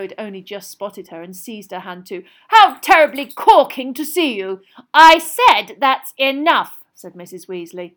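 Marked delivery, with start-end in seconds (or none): 2.5-4.6 loud, high gushing greeting; hammed-up grand politeness; mock pompous. A narrator's reading. Fred mock-greets his own mother. 4.9-6.8 very slow, very loud reprimand; high, forceful scolding; cross mother. A narrator's reading. Mother scolds more sharply.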